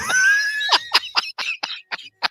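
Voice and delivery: howling high laugh; real laughing fit; loud shrieking laugh. Real, not acted. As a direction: burst out laughing. Explosive laughter at the story.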